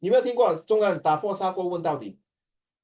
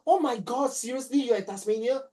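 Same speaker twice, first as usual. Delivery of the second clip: retold surprise; 6 semitones higher than usual. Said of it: "I said".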